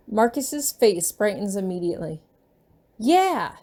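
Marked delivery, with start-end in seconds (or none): 3.0-3.6 put-on high, bright child's cheer. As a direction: cheer out loud like a happy kid. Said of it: "he cheers".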